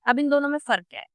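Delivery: ordinary delivery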